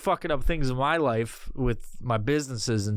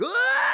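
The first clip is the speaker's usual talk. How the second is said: high, loud cartoon shriek; a sudden wild squeal like a flailing tube man; manic scientist yelp; 4 dB louder than usual. Imitating the twitchy scientist, high squealing yell. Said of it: "he's, like, going".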